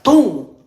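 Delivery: loud, high boom; sudden boom. Shown as a demonstration. Showing how to jump in once used to it, loud boom. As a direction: burst out with one boom. Acts out jumping in there.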